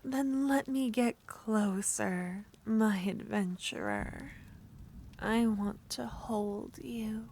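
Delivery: usual speaking voice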